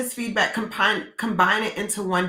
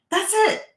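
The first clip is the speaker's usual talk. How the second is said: high, punchy "That's it"; a sudden high-pitched "That's it"; emphatic; 12 semitones higher than usual. Real, not acted. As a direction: land it high and final. Punchline about doing the work.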